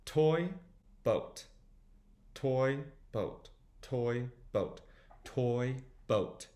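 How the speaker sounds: separated words with pauses; slow demonstration. Shown as a demonstration. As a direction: sound out each word on its own. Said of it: "Try saying it a few times fast".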